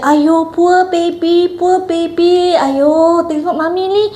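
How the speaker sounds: high, drawn-out mock baby talk; imitated nurse's sing-song sympathy; nurse cooing to baby. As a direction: coo in pity over a crying baby, slow and high. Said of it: "she took the baby away from me and she said".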